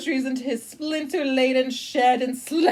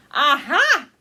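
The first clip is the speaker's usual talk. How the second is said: louder, caught-you cry; triumphant aha; 6 dB louder than usual. Acted. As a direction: pounce on the slip. Narrator catches the alligator out.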